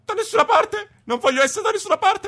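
playful shout. Real, not acted. Fleeing seeker.

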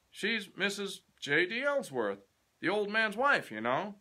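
put-on high, loud chatty old boss; a proud old clerk boasting of the past; garrulous old man, loud and high; old Mister Fisher. Mister Fisher voiced in the story.

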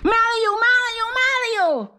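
very high, strained calling out; high panicked call for Mario. Acted. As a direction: shriek for your brother.

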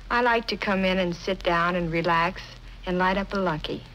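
ordinary talk

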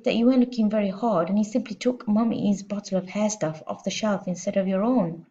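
ordinary delivery, nothing marked

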